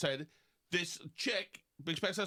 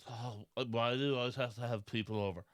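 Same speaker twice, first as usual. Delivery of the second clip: lower, grumbling imitation of the aunt; mocking complaint; 6 semitones lower than usual. Mimicking the aunt complaining about guests, low and grumbly. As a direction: sneer it like a nagging houseguest. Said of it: "complain about things".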